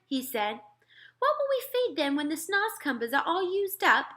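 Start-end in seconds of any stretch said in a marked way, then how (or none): 1.2-4.1 higher, curious queen's question; a lightly voiced queen wondering; the queen asks the giant, higher and curious; queen asking. A narrator's reading. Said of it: "the queen asked him".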